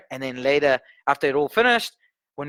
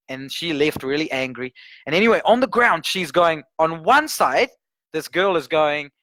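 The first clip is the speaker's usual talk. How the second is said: ordinary talk, nothing marked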